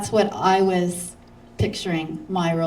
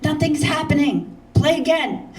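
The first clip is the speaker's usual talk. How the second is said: urging Milton; 7 semitones higher, 4 dB louder than usual. Retold or imitated. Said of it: "Milton goes".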